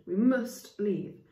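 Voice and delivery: usual speaking voice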